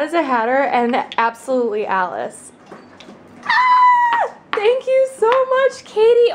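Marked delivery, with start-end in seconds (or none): 3.4-6.3 high, loud delighted squeal of thanks; thrilled squeal. Real, not acted. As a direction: gush with joy at the gift. Thrilled opening fan package.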